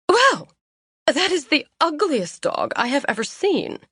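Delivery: haughty society lady, disdainful; snooty sniff. Acted. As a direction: look down your nose at the dog. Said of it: "the woman sniffed".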